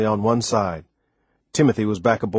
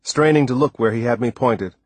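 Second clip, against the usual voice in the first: usual speaking voice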